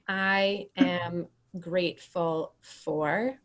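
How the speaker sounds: "I am grateful for" drawn out slowly, left open with a rise; fill-in-the-blank prompt. Shown as a demonstration. Host prompts the group to fill in the blank, slow and open-ended. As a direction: stretch "I am grateful for" slowly and lift the end for a blank. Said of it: "fill in the blank".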